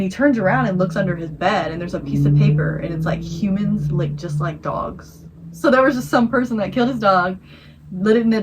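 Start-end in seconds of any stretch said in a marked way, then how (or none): 3.2-5.3 slow, deliberate monotone; drawn-out scary-story punchline; creepy note read out. Retold or imitated. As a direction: read it word by word, evenly.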